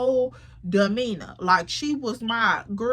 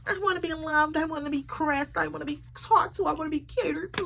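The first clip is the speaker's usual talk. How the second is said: high, needy whining mock voice; mock a needy pick-me girl begging for attention; high mock whine of a needy woman; 6 semitones higher than usual. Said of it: "One of those".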